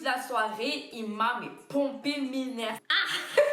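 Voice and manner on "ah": sharp cry then high laugh. Real, not acted. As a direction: burst out laughing at the memory. Laughs at annoying guard. (éclat de rire aigu)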